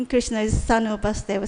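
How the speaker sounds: ordinary delivery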